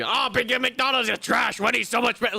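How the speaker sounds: high, loud, indignant scoffing; mock heated snob. Streamer imitating someone trashing McDonald's, indignant. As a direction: huff it like a fast-food fanatic.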